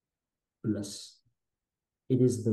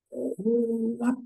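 startled what; 12 semitones higher than usual. Retold or imitated. Sudden shock mid-fall.

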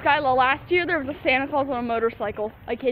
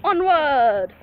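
heroic cry; 3 semitones higher, 7 dB louder than usual. Pretend. Bold proclamation.

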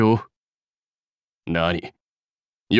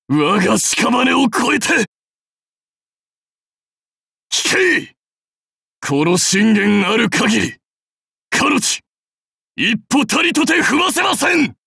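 high, forceful commands; last-stand cry; 6 semitones higher than usual. Acted. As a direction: shout defiantly to the troops. (決死の覚悟で叫ぶ)

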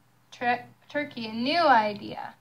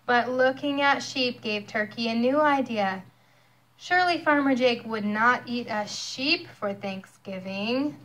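ordinary delivery

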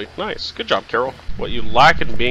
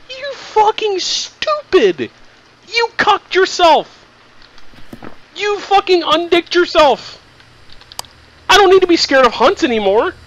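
gloating shriek. Real, not acted. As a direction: drag out the insult at the ghost. Mocking the djinn, high and drawn.

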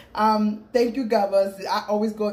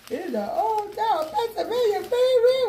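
excited; 14 semitones higher, 3 dB louder than usual. Real, not acted. Shows off her sandwich.

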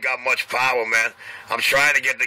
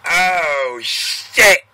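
drawn-out, higher "oh", then a louder punch; mock shocked realization; 5 semitones higher, 5 dB louder than usual. Listener finding the root of an argument, mock shock. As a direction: act out a sudden startled discovery. Acted reaction on rewinding an argument.

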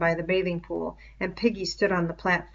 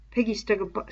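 ordinary delivery

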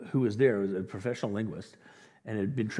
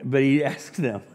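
ordinary talk, nothing marked

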